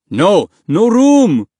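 loud, rising refusal; shouted refusal. A narrator's reading. Passenger to pleading boy, hard loud refusal.

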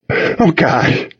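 high, breath-caught gasp. A narrator's reading. Owner seeing the dog's blood, gasping. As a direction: gasp it out in shock. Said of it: "I gasped".

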